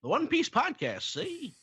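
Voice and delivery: high announcer voice, lightly done; old-timey announcer. Shown as a demonstration. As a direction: announce the show title like an old radio host. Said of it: "my old-timey announcer voice".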